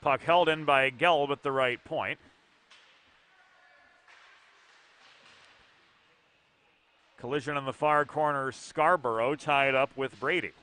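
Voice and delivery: brisk hockey call, following the puck; live play-by-play. Acted. Play-by-play caller describing the hockey game, brisk and steady. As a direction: call the action as it unfolds.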